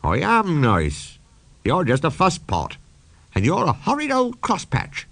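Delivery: put-on playful girl scolding an old engine; young girl voice. Nancy's lines, child voice.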